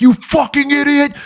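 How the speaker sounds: high, loud mock yell; mock screaming. Podcast hosts screaming insults at the guest, mock yell. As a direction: shriek the insults like an enraged host. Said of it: "they start screaming".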